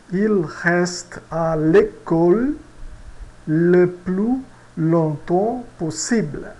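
Il reste à l'école read slowly and clearly; slow French example. Shown as a demonstration. Teacher reading the example sentence slowly to students. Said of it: "Vamos ver a nossa frase exemplo".

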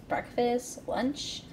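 usual speaking voice

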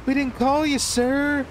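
high, put-on polite voice; prim caller. Imitating the other guy politely correcting the taxi man, in a high prim voice. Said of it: "The other guy's like".

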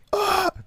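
sharp high pained gasp. Acted. Asked for a cry of pain, a sharp gasp. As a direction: gasp like you've just been struck.

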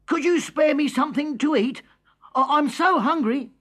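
put-on small boy pleading. A narrator's reading. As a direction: plead like a hungry little boy. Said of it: "he said".

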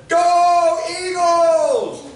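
long, high, loud "Go Eagles!"; cheering back. Retold or imitated. Pastor cheering back to the boy, stretched loud shout. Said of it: "I looked back at him and I said".

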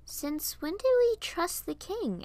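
high, slow query; confused questioning. A narrator's reading. Piper looks at Varian in confusion, puzzled. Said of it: "looked at him in confusion".

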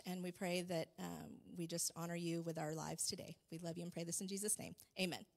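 soft, earnest prayer voice, dropping low on Father; closing prayer. Acted. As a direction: pray gently over the congregation.

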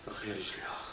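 whispered groan. Acted. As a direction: breathe out the pain.

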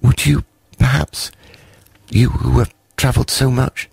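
high hesitant small voice; cautious creep. A narrator's reading. Creep cautiously asking Snufkin about his travels, high and hesitant. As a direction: ask carefully, half afraid. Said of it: "asked cautiously".

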